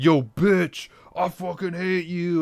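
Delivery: hammed-up boyfriend snarling at her; angry cheating boyfriend. Boyfriend on a talk show yelling at his girlfriend, hammed-up. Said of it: "he's like, he's like".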